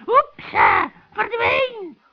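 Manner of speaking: loud, abrupt magic command; commanding spell. Acted. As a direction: shout the banishing word. Witch orders spirit back into bottle. (luide toverspreuk)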